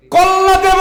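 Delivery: very high, full-force shout; defiant roar. Real, not acted. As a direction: roar the vow to the congregation. Defiant vow shouted in preaching. (গলা ফাটিয়ে দৃপ্ত চিৎকার)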